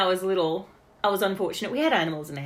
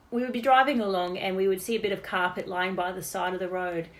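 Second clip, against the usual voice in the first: normal talking voice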